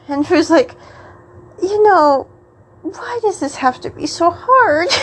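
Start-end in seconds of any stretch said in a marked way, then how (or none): 1.6-4.9 higher, half-crying complaint; mock exasperated wail. Struggling to move drunk friend.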